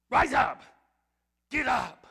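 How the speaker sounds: loud, sharp order; commanding shout. Acted. God ordering Joshua to his feet, loud and sharp. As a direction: bark the command. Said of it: "God says to Joshua".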